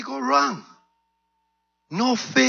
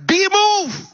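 high, forceful command; explosive preacher's command; commanding shout; 10 semitones higher, 3 dB louder than usual. Acted. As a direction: order the mountain away with full authority. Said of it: "he looks straight at the mountain and says".

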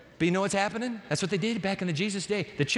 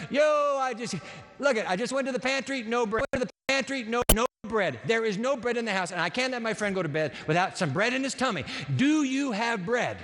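higher, urgent, rushed neighbour at midnight; pleading friend; 3 semitones higher than usual. Acted. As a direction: beg for bread at the door, out of breath.

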